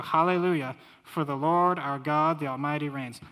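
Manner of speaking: loud, ringing proclamation of scripture; scripture reading. Acted. Preacher reads Revelation nineteen aloud to his congregation, ringing out. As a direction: proclaim it like the roar of heaven's crowd.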